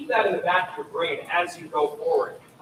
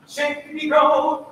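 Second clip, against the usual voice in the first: higher, louder bounce on Chez Negro; bouncy; 9 semitones higher, 6 dB louder, much slower than usual. Shown as a demonstration. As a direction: lift and bounce it. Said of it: "let your notes bounce".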